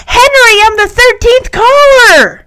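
high, loud squeal of triumph, last word stretched; thrilled shriek. Acted. Punky tells Henry she got through, overjoyed. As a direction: burst out with huge excitement.

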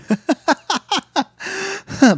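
high, staccato imitated cackle; nervous crazy laugh. Host imitates Bray laughing at the rabbit, nervous crazy laugh. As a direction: imitate a string of crazed, nervy laughs. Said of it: "but, like, nervously crazy laugh".

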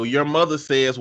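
normal talking voice

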